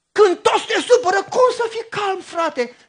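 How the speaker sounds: high, loud ranting climax; exasperated shout. Acted. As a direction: preach the frustration at full force. Predicatorul strigă exasperarea. (strigăt exasperat de predică)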